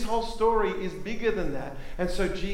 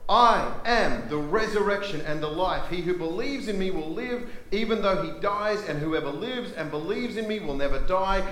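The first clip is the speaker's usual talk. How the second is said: raised, emphatic preacher's voice as Jesus; challenging; 4 semitones higher than usual. Acted. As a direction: press the challenge home, slowing on the final question. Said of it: "turns around and challenges her".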